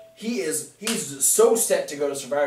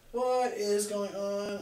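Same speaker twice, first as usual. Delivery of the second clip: soft, slow, higher-pitched bewilderment; baffled; 4 semitones higher, 7 dB quieter, slower than usual. Retold or imitated. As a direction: draw it out in mock confusion. Acting out Cesaro's bafflement.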